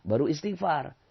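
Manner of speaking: ordinary talk, nothing marked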